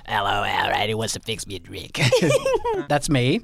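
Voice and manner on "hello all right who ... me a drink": low, soft mechanical voice; mock electrolarynx; voice-box impression. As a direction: croak through a voice box. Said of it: "impression of somebody with a tracheotomy".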